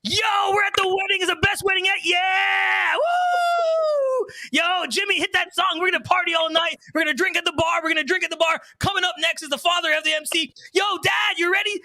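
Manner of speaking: high, shouted wedding hype; hammed-up, high-pitched hype man; over-hyped MC. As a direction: imitate a manic party MC yelling nonstop. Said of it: "you got the other ones".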